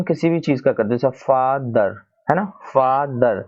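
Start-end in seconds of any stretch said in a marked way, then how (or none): 1.1-1.9 father in two parts, "फा - दर"; split in two syllables. Shown as a demonstration. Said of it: "आपने दो part में बोला ना". 2.4-3.4 फादर said slowly as "फा - दर"; split in two syllables. Shown as a demonstration. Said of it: "आपने दो part में बोला ना".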